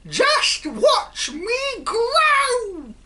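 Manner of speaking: put-on shrieking old hag's whoop, very high and loud; cackling witch-like cry of glee; old hag shrieking with delight; screeching shout. A narrator's reading. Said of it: "she shouted".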